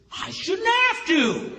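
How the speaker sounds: slow, drawn-out and affronted; indignant retort. Retold or imitated. Churchwoman telling the pastor why she asks once, slow and indignant. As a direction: lean on every word like it's obvious.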